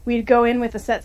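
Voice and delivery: usual speaking voice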